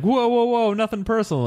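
high, loud mock backpedal; hammed-up Jon pleading innocence; mock placating Jon. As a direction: throw your hands up, high and fast. Jon voiced backing off.

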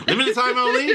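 laughing while asking. Real, not acted. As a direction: giggle through the words. Laughing through a joke.

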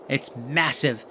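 ordinary talk, nothing marked

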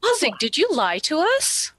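high, mock-wounded question; patronizingly upset. [name] questioning Bazig about lying, patronizingly upset. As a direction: play the disappointed mom. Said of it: "like, patronizingly upset".

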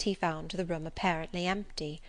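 ordinary delivery

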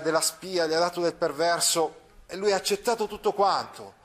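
ordinary delivery

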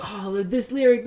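a hint of dreamy awe, slow; slow starstruck awe. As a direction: savour it like a fan. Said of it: "I saw [name] be excited".

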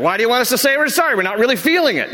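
high, quick protest; mock defensive guy. Men protesting to wives, high and quick. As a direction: whine like a husband who won't apologise. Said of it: "It's like".

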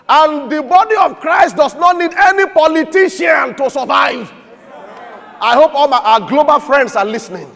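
loud, high preaching burst; preacher shouting a rebuke, then dropping. Acted. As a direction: thunder it at the congregation. Preaching at full force.

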